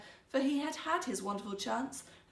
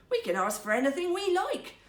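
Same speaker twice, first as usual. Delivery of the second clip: higher, louder delight; excited; 8 semitones higher, 6 dB louder than usual. Acted. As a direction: let the excitement spill out. Husband excited about wishes.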